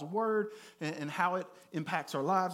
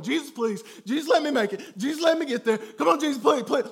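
higher, louder pleading to Jesus; imitating a panicked plea; desperate retold prayer; 6 semitones higher, 6 dB louder than usual. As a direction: beg for your life on the ride. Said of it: "I'm crying out to him".

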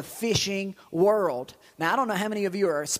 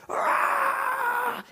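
high, wild whoop of triumph; excited yell. Retold or imitated. Preacher imitating a fisherman's victory yell. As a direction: yell like you just landed a trophy fish. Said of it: "He's like".

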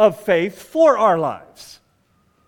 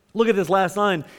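ordinary talk

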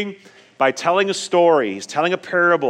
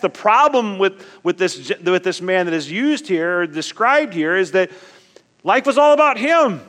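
preaching at full force; 6 semitones higher, 5 dB louder than usual. Acted.

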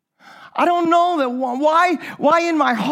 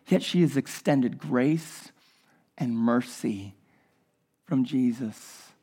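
low, hushed preaching, much softer and lower, unhurried; soft intimate sermon; 12 semitones lower, 8 dB quieter than usual. Acted. Preacher telling his congregation of Jesus and the woman, hushed and intimate. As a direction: draw the room in close with a quiet voice.

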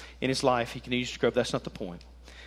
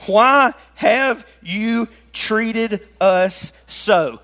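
slow, loud, each word hit separately; upset mother; 6 semitones higher, 7 dB louder, much slower than usual. Acted. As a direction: scold your son slowly and loudly, word by word. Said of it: "And his mother said to him".

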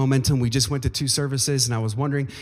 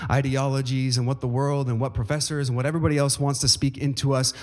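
ordinary talk

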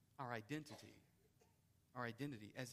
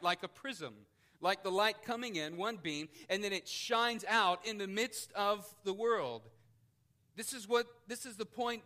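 louder, higher, ringing out each phrase; preaching at full force; 6 semitones higher, 9 dB louder than usual. Acted. Preacher reading scripture to the congregation, forceful preaching. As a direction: proclaim it to the whole room.

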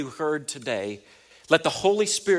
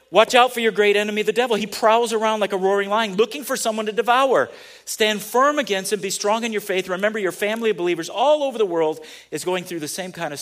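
loud, higher scripture proclamation; preaching at full force; 6 semitones higher, 8 dB louder than usual. Acted. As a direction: proclaim the verse like a warning cry.

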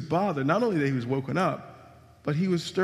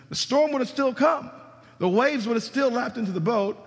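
much higher, louder preaching peak; preaching climax; 8 semitones higher, 4 dB louder than usual. Acted. Preacher telling the congregation the storm still comes, rising loud. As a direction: build to a ringing climax.

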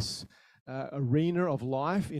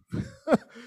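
a short high burst of laughter; quick high chuckle after the punchline. Real, not acted. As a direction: laugh at your own joke.

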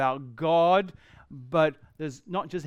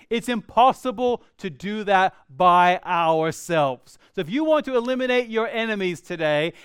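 rising, louder, quicker preaching; fervent preaching; 5 semitones higher, 6 dB louder than usual. Real, not acted. Pastor urging his congregation, louder and faster. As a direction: press the point home with building fervor.

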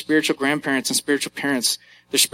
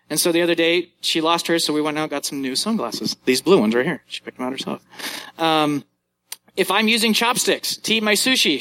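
ordinary delivery

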